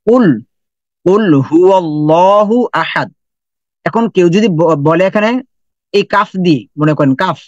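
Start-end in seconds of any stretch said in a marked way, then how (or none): 0.1-3.1 slow reverent recitation; Quranic recitation. Shown as a demonstration. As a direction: chant কুল হুয়াল্লাহু আহাদ slowly and reverently. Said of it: "প্রথমে আমরা বলি কি".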